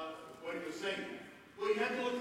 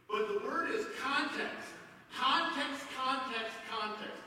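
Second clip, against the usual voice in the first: each context hit hard, first one highest; stressed repetition; 4 semitones higher, slower than usual. Shown as a demonstration. Preacher drilling the congregation on context. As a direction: drive home context, context, context. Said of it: "That is so important".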